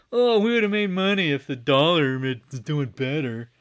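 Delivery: high, loud whiny complaint; hammed-up grumbling imitation of executives; mock whining. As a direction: whine it like a sore loser. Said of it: "they literally whined and complained".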